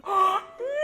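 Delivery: high, sharp gasp of delight; excited gasp. Real, not acted. Spotting a beloved childhood moment, high delighted gasp. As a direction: gasp as the memory hits. Recognizing the show.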